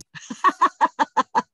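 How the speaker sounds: a high, bright burst of laughter; real high laugh. Real, not acted. Host laughing after her remark, real high laugh. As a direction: laugh freely at your own remark.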